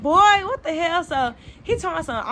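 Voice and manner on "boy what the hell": put-on higher, louder shocked "boy, what the hell"; shocked outburst. Woman shocked her ex is outside, exclaiming. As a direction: caught off guard, blurt it out.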